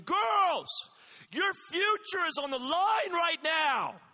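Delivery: imagined shout. Retold or imitated. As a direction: bellow it at the distracted girls. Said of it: "In my head, I'm thinking".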